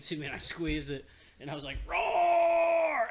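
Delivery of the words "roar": loud high hammed-up roar; mock roar. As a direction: roar like a beast straining the grip tester. Squeezing grip meter, retold roar.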